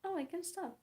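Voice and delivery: high-pitched mock resolve; self-argument, hopeful side. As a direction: pitch it up, acting out wishful self-talk. Acting out argument with herself.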